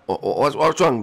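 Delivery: ordinary delivery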